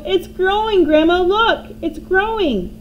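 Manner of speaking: high, loud excited calling; excited boy calling. A narrator's reading. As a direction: shout up to her, thrilled. George calling to Grandma.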